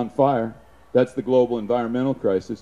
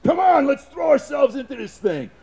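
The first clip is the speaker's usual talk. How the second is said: rallying shout; 12 semitones higher than usual. Acted. Rallying call to shoulder door.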